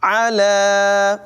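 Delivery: a single word stretched very long; Quran recitation. Shown as a demonstration. Teacher shows the stretch to students, slow drawn chant. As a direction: hold the vowel in a slow chant.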